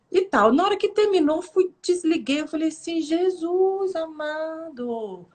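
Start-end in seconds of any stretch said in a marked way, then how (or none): 3.0-5.3 drawn-out, higher-pitched amazement; amazed exclamation. Retold or imitated. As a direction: stretch it out in retold awe. Reage espantada ao desligar a live. (espanto arrastado)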